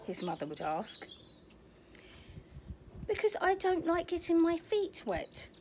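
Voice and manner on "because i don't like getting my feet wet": lightly voiced small child, a bit higher; a hint of a fussy little boy; little otter's voice. Oliver telling his mother why, lightly voiced child.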